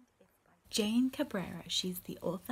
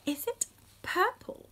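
high questioning; 9 semitones higher, 4 dB louder than usual. Acted. Reading questions to children.

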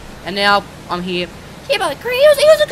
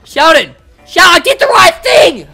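loud, high pleading yell; insistent shout; 8 semitones higher, 11 dB louder than usual. Real, not acted. Yelling at Sheldon for approval, loud insistent shout. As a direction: shout to make him agree. Pleading after being ignored.

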